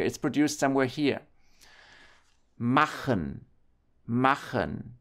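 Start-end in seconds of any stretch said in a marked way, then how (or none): 2.6-4.9 throaty ch after A in machen; back ch in machen. Shown as a demonstration. German teacher showing ch after A, back ch in machen. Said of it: "This is pronounced".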